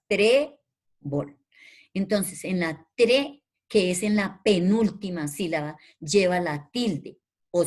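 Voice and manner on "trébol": syllable-by-syllable "trébol" for a class; split into syllables. Shown as a demonstration. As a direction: hold "tré" firmly, break, then add "bol". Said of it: "dos, dos sílabas".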